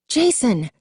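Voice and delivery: high called name, falling off; calling out. A narrator's reading. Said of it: "she called again".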